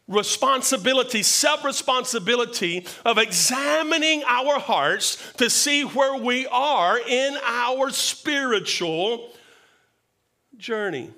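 ordinary delivery

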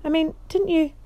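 high, fast, flustered stammer. Acted. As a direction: trip over the words in alarm. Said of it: "he stammered".

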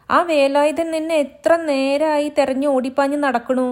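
high, loud village maid calling out; servant woman calling. Acted. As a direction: shout across the yard for the girl. Maid scolds the girl.

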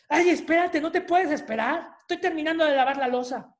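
high, loud, fast annoyed snap; busy mom losing patience with her hungry child, snapping; irritated mom. Acted. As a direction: brush the child off sharply.

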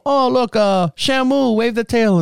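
hammed-up chef mocking a diner; mock hibachi chef. Chef teasing the diner, high mock showman voice. Mimicking chef throwing shrimp.

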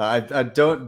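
normal talking voice

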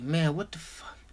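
whispered confusion. Retold or imitated. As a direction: whisper it at the unanswered door. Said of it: "I'm just like".

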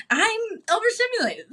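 high, sharp mock snap; snappy. Imitating herself snapping at people, high sharp mock snap. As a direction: bite the word off like you're fed up. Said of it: "walk around snapping at people".

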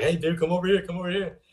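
an animated beckoning, retold; excited call-over. Dad calls son over to hear chords, retold eager beckoning.

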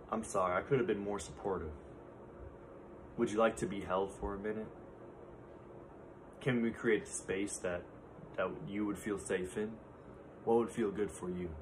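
calm a hurting partner softly; gentle apology. Shown as a demonstration. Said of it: "Can you do this with a partner?".

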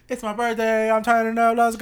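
mock turning up for the birthday; hyped birthday quote. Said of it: "But I've never really been like".

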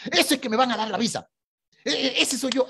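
high, eager and laughing; giddy with hope, half laughing; excited, laughing. Retold or imitated. Isaac excited about visa.

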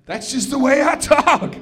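high-pitched comic yelled retort; mock yelling. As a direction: raise the pitch and protest as if yelling. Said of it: "Why are you yelling?".

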